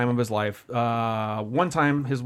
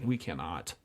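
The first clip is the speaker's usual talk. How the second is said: much lower, soft and grave; quiet dread; 10 semitones lower, 12 dB quieter, slower than usual. Retold or imitated. Driver realising the situation, low quiet dread. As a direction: murmur it low, quietly horrified. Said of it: "I was just like".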